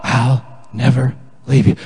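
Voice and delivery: low, gentle voice falling away; God's reassurance. Acted. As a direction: answer low and steady, with a pause before the last words. God's reply to each confession.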